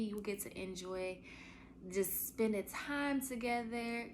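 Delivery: usual speaking voice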